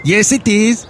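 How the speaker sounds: high, put-on Norwegian accent; fake Norwegian accent. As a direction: insist in a bad Norwegian accent. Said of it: "That's not a Norwegian accent".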